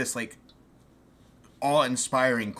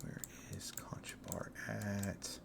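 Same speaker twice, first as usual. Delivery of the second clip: whispering; 3 semitones lower, 16 dB quieter, slower than usual. Real, not acted. GM searching notes quietly.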